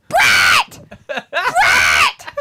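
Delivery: loud mock phone yell. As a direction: bellow the name as a joke, as if down the phone. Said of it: "Just yell into the phone".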